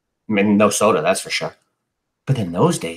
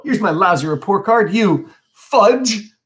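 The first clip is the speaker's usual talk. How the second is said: high, upset outburst, louder at the end; tearful defiance; 8 semitones higher, 3 dB louder, slower than usual. A narrator's reading. Brother flinging over report card, tearful anger. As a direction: throw the insult out high, close to crying. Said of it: "Eugene starts to cry".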